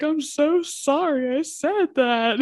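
tearful apology. Retold or imitated. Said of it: "I was like".

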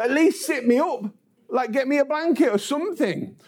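high-pitched mock pleading; exasperated teenage boy. Paperboy lying on floor asking for help, pleading. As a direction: whine up high for any help at all.

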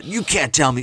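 loud, high mock Boston guy drifting Southern; silly Southern-sounding attempt at a Boston accent; botched Boston accent. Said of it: "doing a Southern accent".

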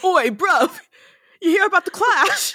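hammed-up British chat-up, cracking up; mock British accent. Mocking the character's British accent.

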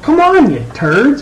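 high-pitched pleading burst, then dropping; exasperated. Retold or imitated. Fundraiser to close friends who haven't donated, exasperated. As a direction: start way up on the plea, fall on the insult.

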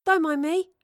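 high, loud cheeky passerby; chirpy voice. A narrator's reading. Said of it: "said a chirpy voice".

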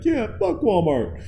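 high, slow, drawn-out agreement; mock eager agreement. Customer agreeing with the news crew, high and slow. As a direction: egg the reporter on, high and slow. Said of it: "And you're like".

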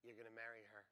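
soft, understated inner voice; hushed retold prompting; little voice. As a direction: say it quietly, as a gentle prompting. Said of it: "a little voice said to me".

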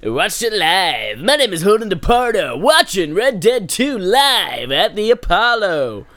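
high, loud hammed-up holler; slow, swooping shouted announcement; mock screaming. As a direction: belt it out like an over-the-top live announcer. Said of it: "I don't know why I'm screaming".

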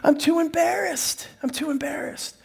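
put-on embarrassed kids. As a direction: squirm like mortified little girls. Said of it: "They're like".

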